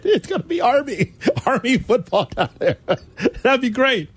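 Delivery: words broken up with real laughter; laughing. Real, not acted. Host laughing at the Army wishbone joke. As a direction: laugh through the line.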